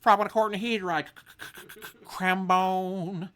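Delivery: high, loud cartoon mouse voice; talking mouse. Mouse comes out to talk, high silly voice. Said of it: "the mouse came out and was like".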